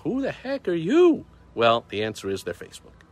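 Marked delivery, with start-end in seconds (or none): 0.0-1.3 high, slow, indignant question; indignant disbelief. Retold or imitated. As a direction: demand it in outraged disbelief. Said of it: "I thought".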